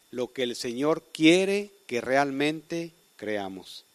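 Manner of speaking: ordinary talk, nothing marked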